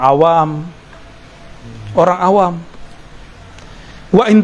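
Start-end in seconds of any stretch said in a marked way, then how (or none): none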